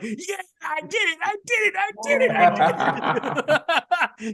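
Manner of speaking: high, loud victory shout breaking into laughter; Rocky-style yelling at the top of the hill; triumphant cheering. Retold or imitated. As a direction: cheer like you just conquered the hill.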